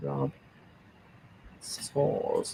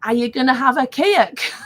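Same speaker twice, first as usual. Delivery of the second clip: higher, louder child's Geordie, badly faked; bad Geordie accent; 5 semitones higher, 4 dB louder than usual. Granddaughter asking granny about birthday cake, badly faked Geordie accent. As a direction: imitate an eager little Geordie granddaughter. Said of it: "my Geordie accent's pants".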